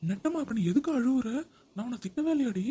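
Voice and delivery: why on earth is she crying; exasperated surprise. Acted. Husband baffled at wife's sudden tears, exasperated.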